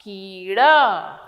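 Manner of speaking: slow teacher demo of the long vowel; slow drawn-out demo. Shown as a demonstration. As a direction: stretch the word out slowly. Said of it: "क में बड़ी की मात्रा है".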